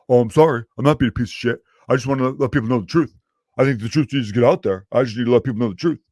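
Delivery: mock an indignant know-it-all insisting on themselves; mock-earnest and loud, pleading their case; self-righteous truth-teller. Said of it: "people who can't help but be".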